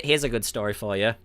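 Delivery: ordinary talk, nothing marked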